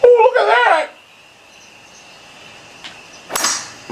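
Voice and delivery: very high, drawn-out exclamation; sudden outcry. Real, not acted. Gamer reacting to the shot, high outcry.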